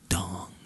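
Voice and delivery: a single ringing "dong"; imitated clock chime. A narrator's reading. Clock striking midnight at the ball, imitated chime. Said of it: "went the clock".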